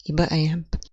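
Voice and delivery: ordinary delivery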